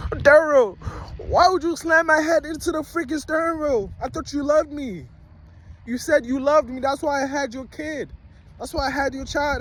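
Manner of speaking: high-pitched imitation of a heartbroken girlfriend; mock crying. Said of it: "She's crying".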